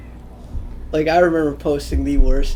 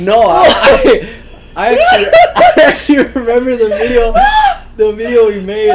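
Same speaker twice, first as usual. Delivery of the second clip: laughing; 9 semitones higher, 7 dB louder than usual. Real, not acted. Laughing hard while telling.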